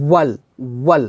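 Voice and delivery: ওয়াল begun with a tightly rounded w; rounded lips. Shown as a demonstration. Teacher drilling the letter waw, ওয়াল with rounded lips. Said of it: "ঠোঁটটা গোল করতে হবে".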